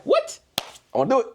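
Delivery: put-on high squeaky kid's shocked "What?"; kid's shocked whine. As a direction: jump way up like a startled little boy. Son's shocked reply, high voice.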